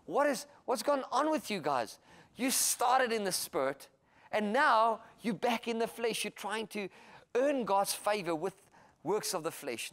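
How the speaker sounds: high, loud, slow exasperated rebuke; a disappointed apostle pleading with them; Paul scolding the church. Retold or imitated. Paul asks the Galatians what happened, exasperated. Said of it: "and he says to them".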